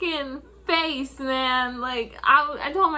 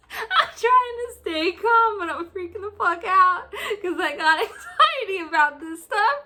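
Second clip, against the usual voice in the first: barely holding it together; laughing; 5 semitones higher than usual. Real, not acted. Laughing retelling panic.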